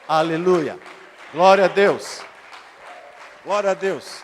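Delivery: very loud pulpit exclamation; explosive hallelujah cry; shouted praise. Real, not acted. Praise exclamations mid-sermon.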